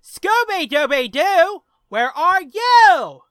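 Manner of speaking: high, slow, belting [name] singer; [name] impression. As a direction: sing it out like [name]. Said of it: "that's my B fifty-two impression".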